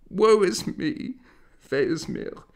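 high, loud wail of fear; frightened matron lamenting on the bench; crying out in dread. Acted. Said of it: "a woman cried out".

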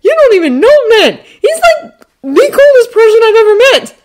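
very high, loud fanboy raving; gushing excitement. A narrator's reading. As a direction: rave like a starstruck boy. Awestruck Ned keeps gushing.

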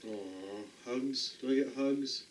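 quiet tender coo while hugging the dog; soft cooing. Real, not acted. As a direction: murmur to the dog close up. Cuddling the dog.

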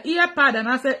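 ordinary talk

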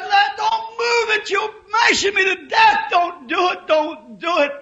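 high, loud cry of pain and panic; boy's desperate pleading. Retold or imitated. Pinned boy begging the men not to lift the car, panicked high cry. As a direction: beg them to stop, voice breaking high.

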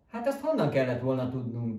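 plain reading voice

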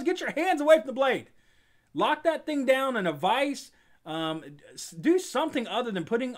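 high, loud safety yell; shouting warning. Acted. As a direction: yell the safety rule at the crew. Shouting safety rule at workers.

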